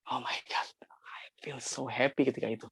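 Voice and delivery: a soft, awed whisper of happiness; hushed and moved, barely voicing it; breathless whisper. Real, not acted. Whispering his joy as his sister hugs him.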